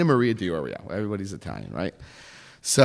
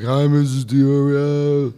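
nervous greeting; 4 dB louder, slower than usual. Retold or imitated. Sheepish greeting.

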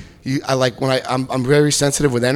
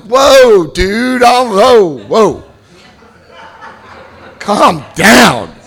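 higher-pitched startled protest; mock alarm; 5 semitones higher, 4 dB louder, much slower than usual. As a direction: back off in exaggerated shock. Startled by order to kneel.

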